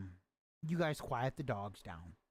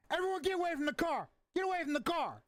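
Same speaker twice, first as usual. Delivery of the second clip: shouted order; 12 semitones higher, faster than usual. Acted. Lieutenant shouting at the group to get away from the car. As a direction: yell at everyone to clear the car.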